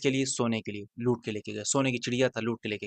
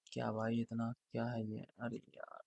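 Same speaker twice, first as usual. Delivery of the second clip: very soft, under the breath; muttering to himself; 2 semitones lower, 15 dB quieter, slower than usual. Real, not acted. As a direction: mutter it privately, barely voiced by the end. Talking to himself while checking the chart.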